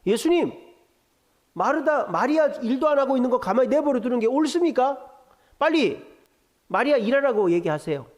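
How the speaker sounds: acted telling-off, pressing for action; Martha. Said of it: "예수님을 야단치는 거죠".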